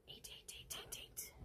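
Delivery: hushed stitch count under the breath; whispered counting. Real, not acted. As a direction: whisper the eights to yourself.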